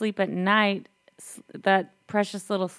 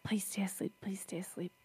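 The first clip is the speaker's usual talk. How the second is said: put-on hushed, begging the baby to stay asleep; soft, pleading hush; pleading whisper; 6 dB quieter than usual. Parent pleading with sleeping baby.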